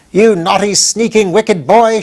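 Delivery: high, loud scolding witch; furious. Acted. Witch Mombi grabbing the spying boy, furious scolding. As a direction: spit out each insult in fury. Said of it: "she exclaimed furiously".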